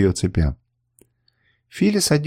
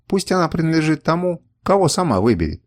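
ordinary delivery

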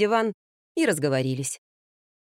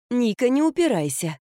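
nanny coaxing; 5 semitones higher than usual. Acted. Nanny dragging the child in.

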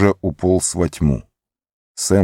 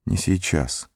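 whispering; 7 dB quieter, slower than usual. A narrator's reading. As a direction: murmur it again, barely audible. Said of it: "прошептал он".

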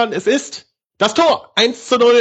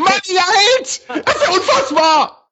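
laughing excited yell; 11 semitones higher than usual. Real, not acted. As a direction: cheer through laughter. Ecstatic commentary with laughter.

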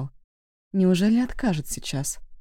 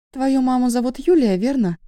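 higher, questioning female character; lightly voiced young woman; 7 semitones higher, 5 dB louder than usual. A narrator's reading. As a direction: ask it in a higher girl's voice. Nina questions the visitor, narrator voices her.

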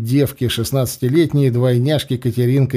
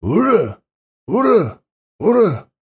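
roaring cheer; 8 semitones higher than usual. Acted. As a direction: roar it out like a whole troop. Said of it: "проревела трижды слаженно".

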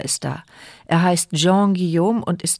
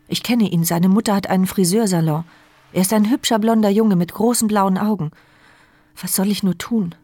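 ordinary talk, nothing marked

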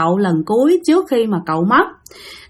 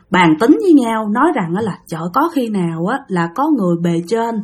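usual speaking voice

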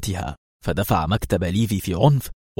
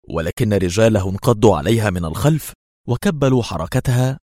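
ordinary delivery

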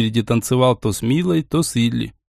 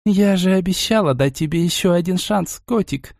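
breathy vintage purr; мурлычет томно и тягуче; томный голос; 5 semitones higher than usual. A narrator's reading. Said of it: "она ответила томным голосом". (томное мурлыканье соблазнительницы)

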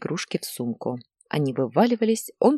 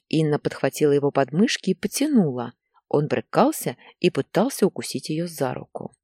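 plain reading voice

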